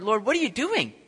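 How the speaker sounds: high, loud complaint; a high, loud, upset protest; upset at the Lord. Retold or imitated. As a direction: protest to God. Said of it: "How often do we get upset at the Lord".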